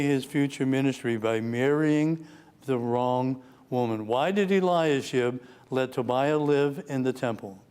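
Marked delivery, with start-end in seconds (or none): none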